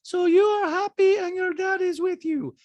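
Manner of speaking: put-on very high-pitched accented caricature; squeaky falsetto mimicry of a reality star; Diana impression. Host mimics reality star in recap, high-pitched caricature. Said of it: "And Diana's like".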